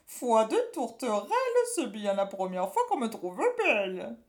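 wiggling, flattered witch; a witch preening happily; coy witch. A narrator's reading. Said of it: "en se trémoussant".

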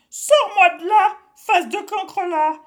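high, loud furious witch roar; a trapped witch raging. A narrator's reading. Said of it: "rugit Cornebidouille".

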